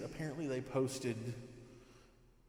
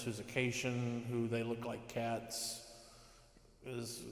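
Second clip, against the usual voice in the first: normal talking voice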